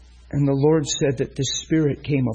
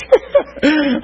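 high laugh; 13 semitones higher, 6 dB louder than usual. Real, not acted. Laughing at own impression.

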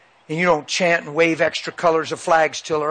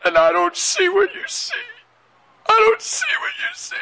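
very high imitated tearful wail; pitiful crying. Son collapsing into dad's arms, imitated pitiful crying. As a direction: squeal it out as a weeping boy. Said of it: "he cried pitifully".

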